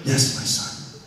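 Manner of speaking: hushed, breathy sage voice; wise cat voice. Retold or imitated. The cat Bulldog answering Kevin like a wise mentor. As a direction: speak like a calm old master. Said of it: "He's like".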